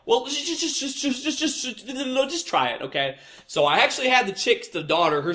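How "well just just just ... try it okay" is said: very high stammering mock voice; mocking pushy customer. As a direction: stutter out her pushy plea, pitched way up. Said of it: "She was just like".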